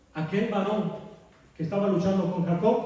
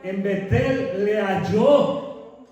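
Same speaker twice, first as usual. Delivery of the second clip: higher and louder, fervent sermon push; preaching at full force; 4 semitones higher, 6 dB louder, slower than usual. Acted. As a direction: raise the voice over the church. (predicando con fervor, más alto y fuerte)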